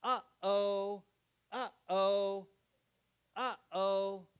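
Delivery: melodic two-note uh-oh; singsong uh-oh. Shown as a demonstration. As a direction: sing it like a little tune. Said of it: "A baby who is not speaking yet can learn to say".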